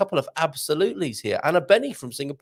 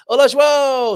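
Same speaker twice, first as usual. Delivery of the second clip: loud, high-pitched hail; called-out greeting; 12 semitones higher, 11 dB louder, slower than usual. Real, not acted. Streamer hailing a viewer in the chat, called out loudly. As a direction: sing out a big hello, well above normal talk.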